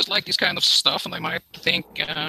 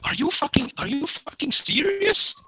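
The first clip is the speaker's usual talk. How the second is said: higher-pitched, incredulous rant; indignant scolding, pitched up; exasperated outburst; 6 semitones higher than usual. Real, not acted. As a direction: berate them in disbelief, voice raised in pitch. Berating host for ruining guest spot.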